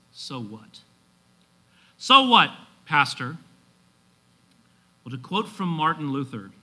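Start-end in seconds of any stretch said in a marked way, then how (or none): 0.1-0.8 low, soft "So what?"; hushed, falling rhetorical question; musing question. Acted. Preacher poses the application question. 2.0-3.4 punchy, raised-voice challenge from the pews; challenging listener. Acted.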